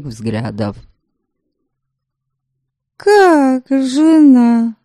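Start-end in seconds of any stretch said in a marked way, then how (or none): 3.0-4.8 high, slow, wide-eyed surprise; naive surprise. Acted. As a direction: gasp it out like a simpleton. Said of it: "простодушно удивилась она".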